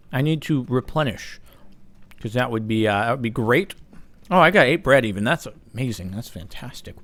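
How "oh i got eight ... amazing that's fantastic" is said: talking with a mouthful; words round a bite of candy; mouth full. Real, not acted. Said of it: "I'm eating with food in my mouth".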